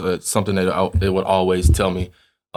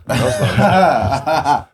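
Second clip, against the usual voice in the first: slow, drawn out through laughter; laughing; 5 semitones higher, 3 dB louder, much slower than usual. Real, not acted. Teammate jokes about being generous with seasoning, laughing. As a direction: laugh the words out, slowly.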